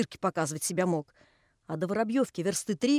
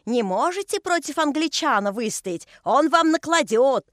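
loud, higher, slowing mocking cry; taunting shout; 5 semitones higher, 4 dB louder than usual. A narrator's reading. Kinga shouting taunts at the workers, loud and mocking. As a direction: jeer at the workers. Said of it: "кричит".

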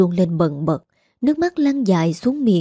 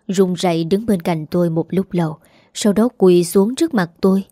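ordinary delivery, nothing marked

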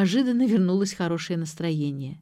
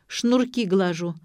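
ordinary delivery, nothing marked

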